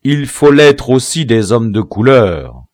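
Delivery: forceful reprimand; stern. A narrator's reading. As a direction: lay down the condition firmly. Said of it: "repartit sévèrement Biassou".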